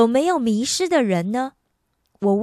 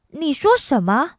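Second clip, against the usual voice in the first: higher, louder startled "what did you say"; surprised question; 6 semitones higher, 4 dB louder than usual. Retold or imitated. 汤马斯惊讶地问伯爵夫人，语调上扬. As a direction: ask it in disbelief. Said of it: "他惊讶地问".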